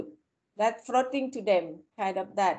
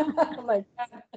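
laughing; 2 semitones higher than usual. Real, not acted. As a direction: laugh it out, caught off guard. IPad talks to her like robot.

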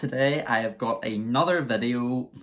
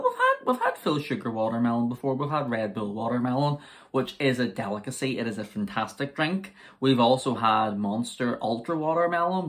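ordinary talk, nothing marked

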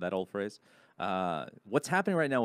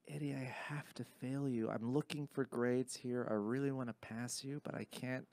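regretful teacher; 5 semitones higher, 5 dB quieter than usual. Retold or imitated. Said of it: "she was like".

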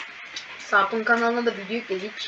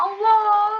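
high loud cry of alarm; frightened cry; 9 semitones higher, 8 dB louder than usual. Real, not acted. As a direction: cry out as you fall. Cry at risky jump.

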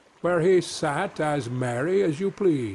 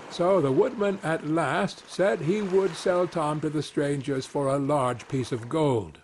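usual speaking voice

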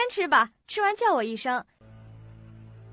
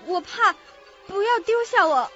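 very high, frightened plea; acted panicky girl's plea; scared pleading; 5 dB louder than usual. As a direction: beg in fear not to be left behind. Scared girl begs not to be left.

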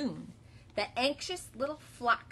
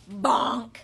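bonk sound effect; 9 dB louder than usual. Acted.

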